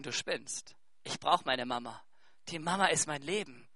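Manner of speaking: put-on hushed child's reply. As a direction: say it small and quiet, like a little boy. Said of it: "dann wird er dir sagen".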